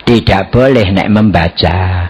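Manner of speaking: usual speaking voice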